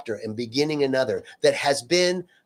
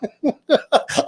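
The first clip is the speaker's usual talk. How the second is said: much higher, loud burst of laughter; high cackling laugh; 13 semitones higher, 6 dB louder than usual. Retold or imitated. Friend laughing about her ex-husband, high cackle. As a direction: break into helpless giggles.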